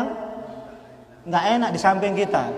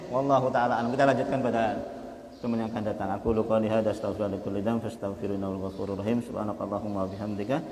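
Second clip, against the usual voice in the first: soft, lowered closing supplication; closing prayer; 9 semitones lower, 7 dB quieter, faster than usual. Acted. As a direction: murmur the closing prayer to finish. (doa penutup yang lirih dan rendah)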